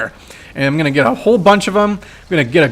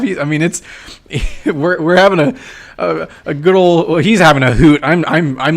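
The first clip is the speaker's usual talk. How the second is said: ordinary talk, nothing marked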